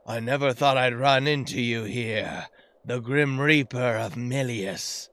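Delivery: low, slow, measured dialogue reading; ominous game character. Acted. Player voicing game character meeting the Grim Reaper, ominous. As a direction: voice the boss's line low and drawn out.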